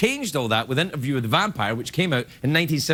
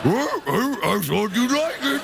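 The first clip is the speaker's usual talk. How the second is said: hurt wolf; 6 semitones higher than usual. Retold or imitated. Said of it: "wolves are terrible at giving gifts".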